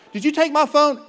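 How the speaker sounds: loud, high accusing question; hammed-up blaming shout at spouse; mock accusation. Acted spouse quarrel.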